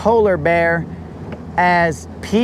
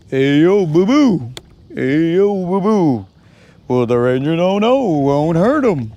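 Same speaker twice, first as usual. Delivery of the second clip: Yogi Bear. Acted. Quoting the bear's catchphrase to the viewers, cartoon impression. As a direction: stretch and swoop each phrase like a cartoon bear. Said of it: "Yogi Bear always says".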